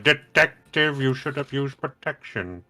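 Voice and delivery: slow, grand villain voice addressing detective; Ra's al Ghul impression. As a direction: savor every word like a villain.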